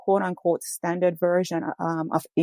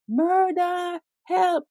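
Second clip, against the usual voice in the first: much higher frightened shout; retold cry for help; 12 semitones higher, much slower than usual. Arrested man crying out to bystanders, high desperate shout. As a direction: cry out like he fears for his life. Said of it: "He was speaking just in Jamaica".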